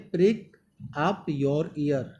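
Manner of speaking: ordinary talk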